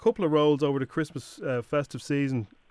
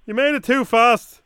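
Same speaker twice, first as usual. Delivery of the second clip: mock director; 7 semitones higher, 9 dB louder, slower than usual. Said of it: "Can you slow that down?".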